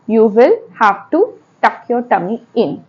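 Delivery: usual speaking voice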